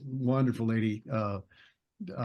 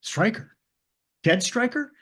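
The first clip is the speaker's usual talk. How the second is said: higher, rising in startled recognition; a quoted double take on the name, pitched up; surprised recognition; 8 semitones higher than usual. Retold or imitated. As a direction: ask the name back, caught off guard. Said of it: "And he says".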